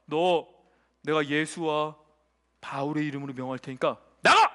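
low, measured command bursting into a shout at the end; exorcist's command. Acted. Magician ordering a demon out, low command into shout. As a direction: intone it low, then explode on the last word. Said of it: "이렇게 해요".

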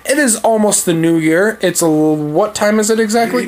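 ordinary talk

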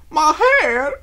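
high, loud wail; bursting into tears; distraught cry. A narrator's reading.

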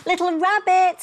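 high, loud worried call. Acted. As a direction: call into the snowstorm. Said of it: "he called".

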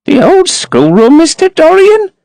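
put-on high, surprised old housekeeper voice; an old servant startled by the request; exclaiming housekeeper. A narrator's reading. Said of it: "she exclaimed".

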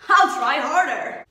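loud, slow, exaggerated promise. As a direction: belt it out slowly, trying harder. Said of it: "Am I not being entertaining enough".

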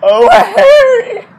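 very high squealing fangirl voice; giddy high-pitched fangirl squeals over her idol; swooning fangirl. Acted. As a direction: gush like a starstruck fan over Harry. Swooning fan, then vain celebrity.

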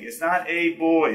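put-on BOY said slowly with a full "oy"; wrong pronunciation demo. Shown as a demonstration. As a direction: stretch out "boy" as the wrong version. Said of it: "harder to say that when you think".

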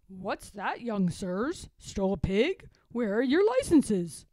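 a policeman catching two piglets; gruff policeman. Acted. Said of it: "said the policeman".

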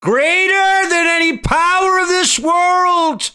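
very high, loud sustained cry; preacher's shout. Real, not acted. Preacher proclaiming God's power to the congregation, full-throated shout. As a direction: shout it out as the sermon's climax.

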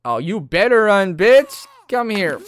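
loud mocking taunt at the enemy; taunting yell. Gamer yelling at villagers, loud gloating taunt.

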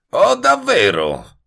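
high, drawn-out mocking question; sneering. A narrator's reading. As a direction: feign astonishment with a loud sneer. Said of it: "sogghignò". (sogghigno beffardo, acuto e strascicato)

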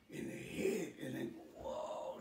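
soft, slow fade on the whoa; acted passing out. Shot man tells his friends then faints, acted fading out.

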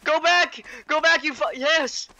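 high, loud frantic directions; excited yelling over game chat; urgent calling out. Real, not acted. Urgently calling teammate back.

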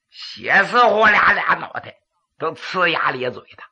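plain reading voice

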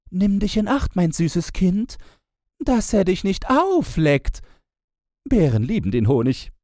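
high, loud sing-song teasing; other mocking sister. A narrator's reading. Second sister taunting the young bride, high and mocking. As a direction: tease sweetly and loudly up high. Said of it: "Diese spöttelten".

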